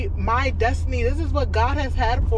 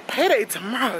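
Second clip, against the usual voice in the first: normal talking voice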